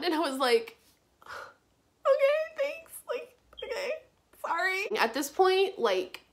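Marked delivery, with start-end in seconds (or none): 1.2-5.0 very high, broken sobbing reply; tearful okay. Retold or imitated. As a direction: squeak it out through tears. Crying girl answers police officer.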